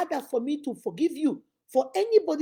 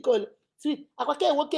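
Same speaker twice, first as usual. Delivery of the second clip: normal talking voice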